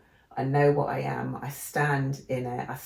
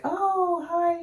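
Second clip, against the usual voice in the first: high sing-song fake friendliness; mock sweet greeting; 4 dB louder than usual. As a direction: put on a sugary welcome. Mimicking Americans' greeting.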